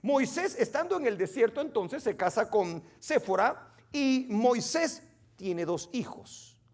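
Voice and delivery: usual speaking voice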